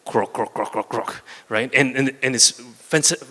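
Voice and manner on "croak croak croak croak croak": soft, low croak, croak, croak like a frog. Retold or imitated. Coach imitates the frog singing in its well, soft low croaks. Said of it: "the frog singing".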